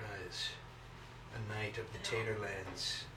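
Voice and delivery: hushed mock ceremonial tone; solemn regal knighting. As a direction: dub him like a playful king.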